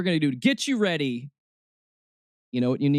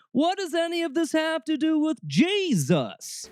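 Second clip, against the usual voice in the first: high-pitched, incredulous plea; exasperated question; 12 semitones higher than usual. Acted. Host asking Christianity the essential question, exasperated.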